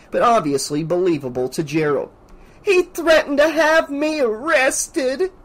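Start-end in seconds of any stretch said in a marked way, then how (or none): 2.6-5.3 high, drawn-out theatrical complaint; hammy victim. A narrator's reading. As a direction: act aggrieved, milking every word. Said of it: "her acting very hammy".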